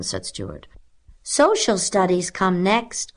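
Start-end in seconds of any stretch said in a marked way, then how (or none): 1.2-3.1 high, slow-paced keen pupil; eager cry. A narrator's reading. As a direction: announce it eagerly, pitched up. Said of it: "cried Elizabeth Gardner eagerly".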